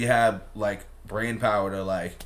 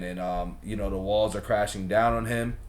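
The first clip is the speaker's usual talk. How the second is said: usual speaking voice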